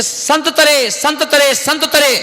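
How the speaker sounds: "संत तरे" sung out high, split into two words with a pause; split call, high. Shown as a demonstration. Monk showing hidden meaning: high split call "संत तरे". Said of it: "क्या बोल रहा है ये चिल्ला चिल्ला के".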